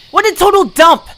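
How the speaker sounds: loud mocking outburst; jeering yell at the dump; scornful shout. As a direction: sneer it out at full volume. Insulting the shabby hotel.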